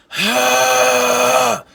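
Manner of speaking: long, loud, high raspy exhale; throat-clearing scream. Shown as a demonstration. Vocal coach showing beginners the starting sound, throat-clearing scream. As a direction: push out a sustained harsh "haah". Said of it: "clear your throat, you know, like".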